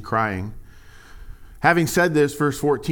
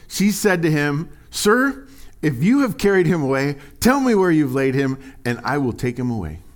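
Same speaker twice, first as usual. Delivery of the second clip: raised, measured Bible reading; lifted reading voice; scripture reading; 3 semitones higher, 5 dB louder than usual. Acted. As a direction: read the verse out to the church. Reads scripture aloud.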